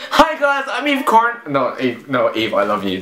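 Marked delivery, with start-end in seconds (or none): 0.1-1.4 high bright greeting; excited intro. Acted. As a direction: hype up the welcome. Redo of vlog intro.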